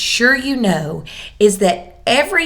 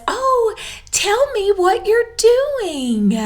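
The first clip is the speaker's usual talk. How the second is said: put-on much higher, slow, sing-song teacher asking a child; sweet teacher voice; 12 semitones higher, slower than usual. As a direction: coax a toddler to share, like a kind preschool teacher.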